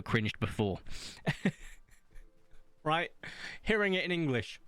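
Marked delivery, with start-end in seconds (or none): none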